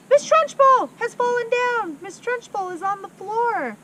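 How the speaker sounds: put-on very high, loud child shriek; alarmed scream. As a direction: scream the news to the class.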